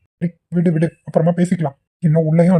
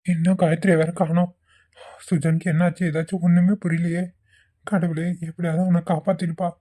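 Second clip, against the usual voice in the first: worried murmur; 9 dB quieter than usual. Acted. Worried inner monologue, much softer.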